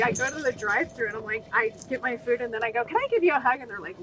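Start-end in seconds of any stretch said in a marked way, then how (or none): 2.8-3.6 high, eager, friendly question; bright cheerful offer. Retold or imitated. Offering a drive-thru worker a hug, bright and eager. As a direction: pop it up brightly, like a surprise gift.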